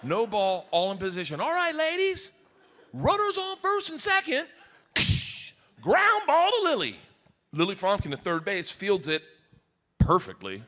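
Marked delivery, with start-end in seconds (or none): 1.4-7.0 high, projected field call; calling coach. Retold or imitated. Softball coach to girls at practice, calling out the play. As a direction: call it out across a ball field.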